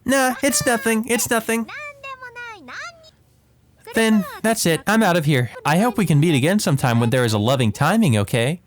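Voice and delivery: a ditzy doctor musing over a wound; playful doctor. Acted.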